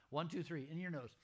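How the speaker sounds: ordinary delivery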